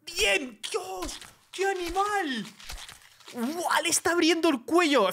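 very high, thin squeal of amazement; high-pitched disbelief at gore; shocked reaction. Real, not acted. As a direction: gasp it out, appalled and thrilled. Reacts to gory kill in game.

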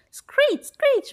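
very high-pitched squeal; possum screech. A narrator's reading. Possum running off through the house, high screech. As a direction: screech like a startled little animal. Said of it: "went the possum".

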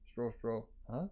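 ordinary delivery

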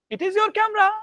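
statement pushed very high and loud as a question; wrong question tone. Shown as a demonstration. Teacher demonstrates the wrong tone, very high and loud.

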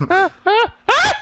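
short high, loud cries; string of high yelps; panicked yelps. Real, not acted. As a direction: yelp repeatedly under attack. Panicking in the game.

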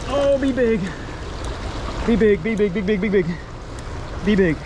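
high, eager chant-like begging; pleading excitement. Real, not acted. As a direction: urge the fish to be big. Hoping the fish is large.

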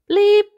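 very high, loud electronic bleep; shrill device beep; machine bleep. Acted. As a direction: sound like a gadget. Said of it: "says the sat-nav".

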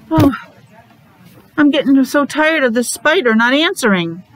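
plain reading voice

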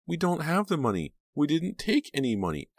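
high-pitched panicky pleading; desperate protest. A narrator's reading. Pam denying taking the money, panicked protest.

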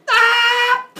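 very loud, high sustained shriek; piercing acted scream; death scream. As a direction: scream as if being vaporized. Imitates woman dying, turning to dust.

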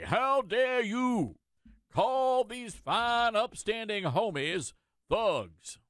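mock enraged mayor. Host mocking the mayor's outrage over the word thugs, slow and hammed-up. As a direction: drag out the indignation like a pompous politician. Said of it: "he's just enraged over this".

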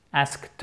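Asked ending in a lightly released t; faint final t. Shown as a demonstration. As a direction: let the last sound of Asked tail off into a small t. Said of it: "un te qu'on entend de manière atténuée".

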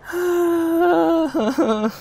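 high, drawn-out moan; despairing whine. Real, not acted. Gamer despairing after losing, whimpering moan. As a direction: let out a long high whimpering sigh.

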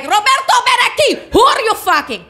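high, loud mock-furious wife; wife demanding who he's sleeping with; jealous wife. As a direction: yell at him from the doorway. Angry wife confronting husband.

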